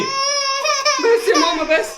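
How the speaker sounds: slow, high crying plea; crying child. Acted. Child crying to mother to stop. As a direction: sob like a frightened kid.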